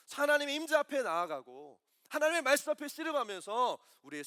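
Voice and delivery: loud, driving sermon delivery; full-force preaching. Acted. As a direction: press it home to the congregation.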